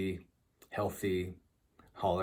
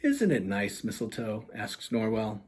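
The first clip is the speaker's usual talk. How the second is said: lively storybook reading voice; animated children's book narration; story narration; 4 semitones higher, 5 dB louder than usual. A narrator's reading. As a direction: read it like bedtime picture book. Author reads picture book aloud.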